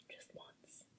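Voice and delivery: breathy longing whisper. Real, not acted. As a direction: whisper it to yourself. Longing aside after wish.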